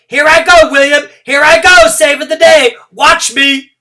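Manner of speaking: very high, loud gleeful yell; excited yell. Acted. Junie B yelling to William as she runs, very loud. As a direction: yell it as you take off. Yelling while starting to run.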